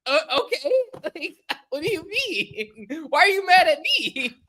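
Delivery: very high-pitched, wounded mock disbelief; bewildered protest. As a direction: squeal it like the baffled injured party. Acts out bewildered reply to angry woman.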